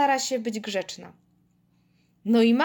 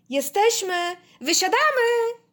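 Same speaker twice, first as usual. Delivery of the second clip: high, loud, rising announcement; calling out; 11 semitones higher, 5 dB louder, slower than usual. A narrator's reading. Telling companion to get off bus, calling out. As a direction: sing out that it's time to go.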